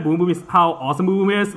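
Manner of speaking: ordinary talk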